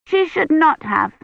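put-on high, disapproving little-girl voice; child firmly finding fault; passing judgment. A narrator's reading. Agnes judges the maiden in Kivrin's tale, disapproving. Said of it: "passing judgment".

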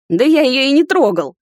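loud, high-pitched denial. A narrator's reading. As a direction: raise your voice in indignant protest. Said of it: "повысил голос принц".

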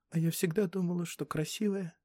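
small, high, soft voice; hurt young girl. Acted. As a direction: ask softly, wounded, in a girl's pitch. Hurt girl doubts her looks. (тихий обиженный голос девочки)

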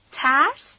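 sharply rising, affronted question; incredulous query. A narrator's reading. Abu Torab bristles when asked about fear, incredulous. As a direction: throw the word back as a challenge. Strongman challenged about being afraid.

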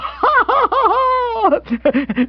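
very high, idiotic guffaw; loud laugh at your own joke; long, silly hooting laughter; braying laugh. Acted.

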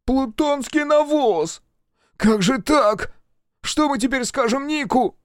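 high, slow, desperate yell. Acted. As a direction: scream it out in despair. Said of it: "закричал во всю глотку".

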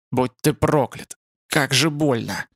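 slow curse through pain. A narrator's reading. Dying spider-man curses Ютланд, slow and pained. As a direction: drag the words out, understated agony.

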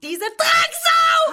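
sehr hoch, laut, gedehnt kreischend; hysterischer Aufschrei. Acted. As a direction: schrill hinausschreien. Hysterischer Ausbruch. (hoher, gedehnter Schrei)

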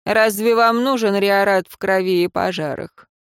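ordinary delivery

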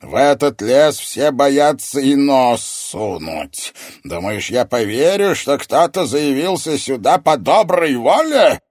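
slow, high, roaring accusation; enraged villain interrogating intruders; thundering sorcerer. A narrator's reading. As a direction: draw out each angry word at full volume. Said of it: "прогремела под сводом".

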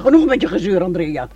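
loud, stern scolding; sharp rebuke. Acted. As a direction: shut her down hard. Mother cuts daughter off sharply. (luid en streng berispend)

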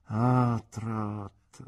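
a wise aunt weighing each word; earnest aunt. Acted.

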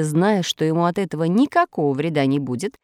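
ordinary delivery, nothing marked

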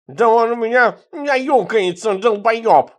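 loud, high, put-on furious voice; enraged threat. A narrator's reading. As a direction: shout a slow, high-pitched threat in outrage.

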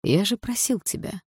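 calm, measured reprimand; surprisingly calm. A narrator's reading. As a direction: reproach them without raising the volume. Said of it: "сказал он всё так же на удивление спокойно".

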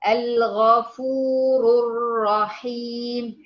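الغفور الرحيم drawn out slowly with a heavy R; slow recitation. Shown as a demonstration. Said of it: "والراء مضمومة". (ترتيل الغفور الرحيم ببطء)

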